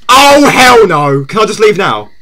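drawn-out loud "hell no", then easing down; loud dismay. Real, not acted. Streamer wanting out of scary game, loud dismay. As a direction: wail the first words slowly, then plead.

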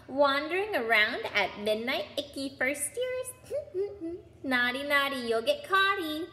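high, teasing poltergeist voice with a giggle; cackling taunt. A narrator's reading. Peeves to first years caught out at midnight, cackling taunt. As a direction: sing it out mockingly, stretched and high. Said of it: "Peeves cackled".

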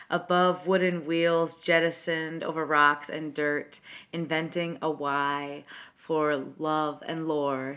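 slow, incantatory poem recitation; poetry reading. Acted.

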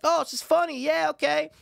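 high-pitched naive delight; imitated young self. Recalling his childhood reaction, high put-on voice. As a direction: pitch it way up like an easily pleased kid.

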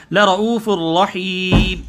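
slow, doubled ra in لَرَءُوفٌ رَحِيمٌ pressed hard; teacher slowing down to show shadda; slow demo recitation. Shown as a demonstration. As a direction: hold and press the ra. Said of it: "را کے اوپر جو ہے تشدید ہے".

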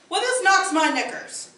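high, loud piglet cry of dismay. Acted. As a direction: yelp it out in a panic. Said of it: "causing pig two to cry out in dismay".

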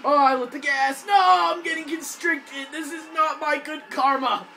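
high, loud desperate yells; panicked shouting. Real, not acted. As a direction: yell while being strangled. Being grabbed by zombie.

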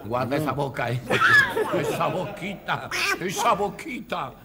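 high squawky cartoon duck voice; Pato Donald voice. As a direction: talk like a cartoon duck, laughing. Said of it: "estaba haciendo la voz del Pato".

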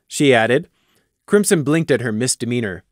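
ordinary delivery

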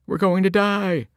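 high, frantic cry; panicked yelling. A narrator's reading. Dazed passengers running in circles, high panicked yell. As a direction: scream like a terrified passenger.

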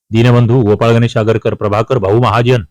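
ordinary talk

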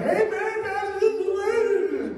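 high, loud comic wail; hammed-up bawling, held high; mock bawling. Pastor retelling himself at the funeral, mock bawling.

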